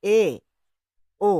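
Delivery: এ then ও said one after the other, each held; paired vowel demo. Shown as a demonstration. Teacher showing students the tongue dropping between এ and ও. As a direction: model এ and ও in turn. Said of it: "এ এবং ও পরপর দুটো উচ্চারণ করো".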